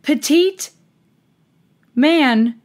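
normal talking voice

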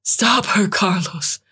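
high, urgent exclamation; playful panic. A narrator's reading. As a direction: cry out for help in mock alarm. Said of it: "His tone of playful panic".

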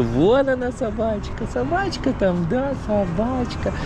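very high cooing voice. Real, not acted. Pointing out dog to toddler.

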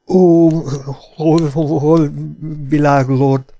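high, loud, stuttering plea; pleading, teeth chattering. A narrator's reading. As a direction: beg shakily through chattering teeth. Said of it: "könyörgött fogvacogva".

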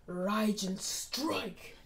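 very slow, drawn-out move name; attack call. Acted. Naruto raises his hand at Haku, drawn-out attack call. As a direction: stretch out each word of the attack.

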